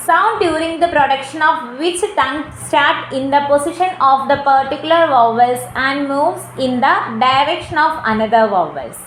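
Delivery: slow, word-by-word dictation pace. Shown as a demonstration. Teacher slowly dictates the diphthong definition to students. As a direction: read it out slowly so students can write it down.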